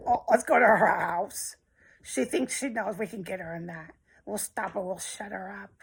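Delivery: hushed plotting mimicry fading to near silence; scheming geek. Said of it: "fucking speed freak".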